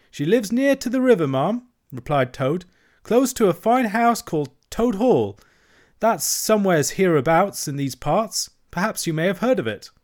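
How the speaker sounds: high, chattering washerwoman voice; Toad as washerwoman. A narrator's reading. Toad playing a washerwoman to the barge woman, high and fussy. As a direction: pile on the hard-luck tale, pitched high.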